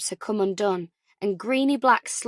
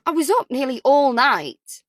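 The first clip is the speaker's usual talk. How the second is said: defensive; 4 semitones higher, 7 dB louder than usual. Acted. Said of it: "Ellie's reply sounded defensive".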